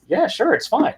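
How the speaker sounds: high, airy yes in mock ease; mock breezy agreement. To the R E M fan, a lightly voiced breezy okay. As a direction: brush it off, pretending all is fine. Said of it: "I'm like".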